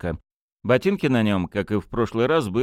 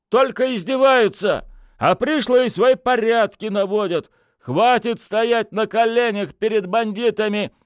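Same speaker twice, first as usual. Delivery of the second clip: high, loud indignant crowd; angry shouting; 5 dB louder than usual. Acted. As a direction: yell it out furiously. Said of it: "послышались крики".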